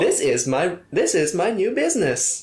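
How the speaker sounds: robot voice. Retold or imitated. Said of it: "Is it in the robot voice?".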